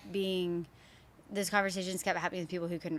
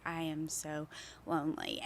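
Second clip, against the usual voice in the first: subdued confession; 3 semitones lower, 9 dB quieter than usual. Retold or imitated. Retold feeling of loneliness.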